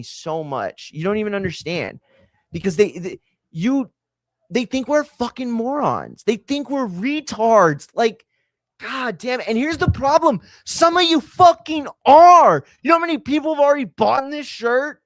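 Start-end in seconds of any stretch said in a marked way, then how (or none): 3.5-15.0 real high-pitched exasperated rant; worked-up, indignant outburst; crashing out. Real, not acted. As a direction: rant at the audience, voice climbing high. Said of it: "I'm crashing out".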